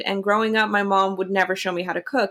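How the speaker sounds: ordinary talk, nothing marked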